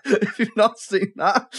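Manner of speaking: slow, laughing through the words. Real, not acted. Podcaster apologising for a spoiler, laughing. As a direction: giggle out the warning.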